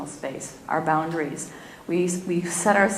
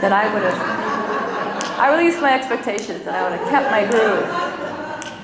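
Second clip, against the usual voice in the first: chuckling while speaking; laughing through it; 3 dB louder than usual. Real, not acted. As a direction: laugh through the words at the interruption. Phone rings, laughing it off.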